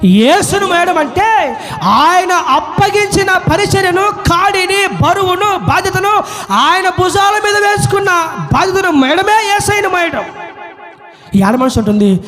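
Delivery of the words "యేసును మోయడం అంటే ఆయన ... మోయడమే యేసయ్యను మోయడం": high, ringing climactic list; preaching at full force. Real, not acted. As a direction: build the sermon point forcefully, item by item. (ఉద్వేగంతో ఎలుగెత్తి ప్రసంగిస్తూ)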